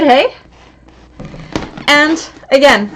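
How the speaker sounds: ordinary talk, nothing marked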